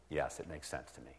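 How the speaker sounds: a low, quiet voiced yes from the listener; quiet agreement. Shown as a demonstration.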